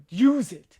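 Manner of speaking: loud, high mock-dramatic exhortation; impassioned urging. Director rousing Sydney in a retold speech, impassioned. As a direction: urge it like a director mid-speech. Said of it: "a very impassioned speech".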